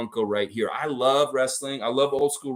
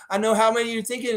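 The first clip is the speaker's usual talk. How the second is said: lightly voiced movie tough guy, pitched up; a hint of a quick, higher tough-guy quote; Clint Eastwood; 8 semitones higher, faster than usual. Fan casting the player as an avenger, lightly voiced tough guy. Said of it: "It's kinda like Clint Eastwood".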